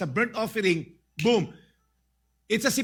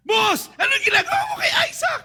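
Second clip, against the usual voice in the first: much higher, loud panicked yell; alarmed shout; 7 dB louder, faster than usual. Acted. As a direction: cry out to stop the knife. Servants shout at Abraham.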